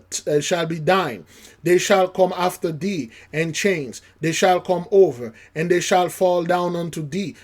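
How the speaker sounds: loud, ringing scripture reading; preaching scripture. Acted. Preacher booming scripture to congregation, loud. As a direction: proclaim it from the pulpit.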